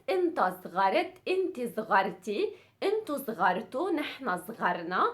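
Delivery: fast conjugation drill; quick-fire teacher recital; really fast. Shown as a demonstration. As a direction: rattle through the forms. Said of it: "conjugate it also really fast".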